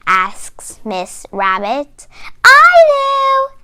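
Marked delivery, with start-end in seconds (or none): 2.4-3.6 high, louder eager shout. A narrator's reading. Susie Sheep shouts to Miss Rabbit, high and loud. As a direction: call out keenly to be picked. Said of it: "shouts Susie Sheep".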